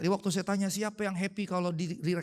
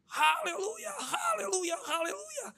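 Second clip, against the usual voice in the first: teriakan tertahan; 13 semitones higher, slower than usual. Retold or imitated. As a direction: cry hallelujah high but held back. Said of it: "betul-betul saya teriak". (teriakan tiruan bernada tinggi, tidak keras)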